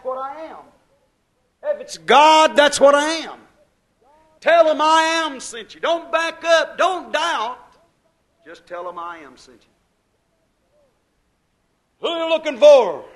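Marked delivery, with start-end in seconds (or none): 1.6-7.6 high, loud pulpit shout; preaching shout. Real, not acted. Preacher urging the church not to doubt, shouting high. Sermon climax shouting to congregation. 12.0-13.0 high, loud challenge; shouted question. Retold or imitated. Preacher acting the soldiers' question, loud and high. Voicing question at full force.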